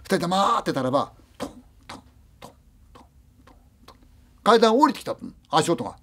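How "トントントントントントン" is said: very soft breathy "トントントン" for steps coming down; whispered footsteps. Retold or imitated. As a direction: barely voice the steps on the stairs. 階段を降りてくる足音. (ささやくような足音)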